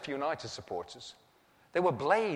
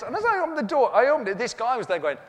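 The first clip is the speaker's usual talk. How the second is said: usual speaking voice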